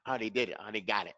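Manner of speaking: quiet, rushed, low throwaway voice; careless student. Acted. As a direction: toss it off as if already done. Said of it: "don't make the end of the word like".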